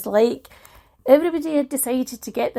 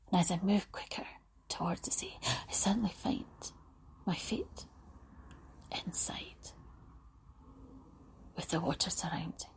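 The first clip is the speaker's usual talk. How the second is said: soft whispered imagery, unhurried; guided visualisation whisper; 3 semitones lower, 14 dB quieter than usual. Acted. Guide listeners to an imagined beach, whispering.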